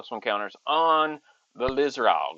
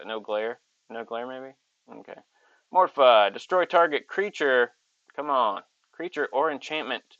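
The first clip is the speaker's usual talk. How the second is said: ordinary talk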